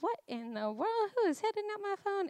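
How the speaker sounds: mildly exasperated, quick high-pitched disbelief; baffled indignation. Retold or imitated. Woken to forty-three missed calls, baffled. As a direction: voice jumping high on the question.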